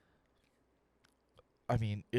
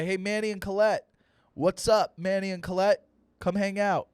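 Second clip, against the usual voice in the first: higher, louder cocky greeting; show-off kid; 5 semitones higher, 4 dB louder than usual. Retold or imitated. Son calling parents by first names, showing off. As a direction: call out to parents for an audience. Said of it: "watch how I'll talk to my mom".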